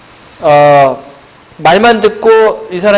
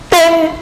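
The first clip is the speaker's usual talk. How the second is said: short, high-pitched "ddaeng" for a wrong answer; mock buzzer "ding"; 12 semitones higher, much slower than usual. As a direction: imitate a quiz bell in a high voice. Imitates wrong-answer buzzer sound. (높은 소리로 흉내 낸 땡 소리)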